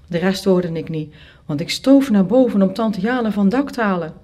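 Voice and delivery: usual speaking voice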